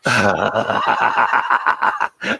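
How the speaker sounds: a real laugh that bursts out near a shout. Real, not acted. Caught out by a cheeky joke.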